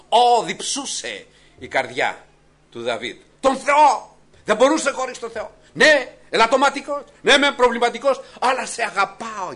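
high, loud preaching cry; fervent preaching. Acted. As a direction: rise up and proclaim it with passion. Impassioned preaching climax. (φλογερό κήρυγμα, δυνατά και ψηλά)

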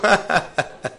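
a short burst of real laughter at the punchline. Real, not acted. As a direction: laugh out at the story's ending. Laughs at his own story.

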